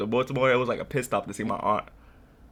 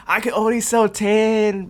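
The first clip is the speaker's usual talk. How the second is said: higher, slow, whiny mock apology; pitiful kid excuse; 9 semitones higher, 5 dB louder, slower than usual. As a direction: play the innocent kid, draw it out. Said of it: "they be like".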